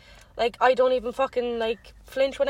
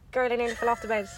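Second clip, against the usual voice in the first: usual speaking voice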